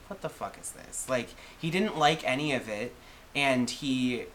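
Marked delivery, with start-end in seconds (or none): 0.1-1.1 unvoiced, disdainful whisper; whispered disgust. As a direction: breathe it out in scorn. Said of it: "he was just like".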